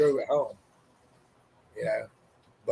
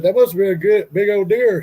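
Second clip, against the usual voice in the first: louder teasing remark; 5 semitones higher, 10 dB louder than usual. Retold or imitated. Teasing wife about the deer.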